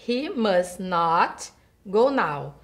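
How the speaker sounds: "he must not go now" read slowly and clearly; slow model sentence. Shown as a demonstration. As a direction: lay each word out slowly for learners. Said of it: "Forma negativa".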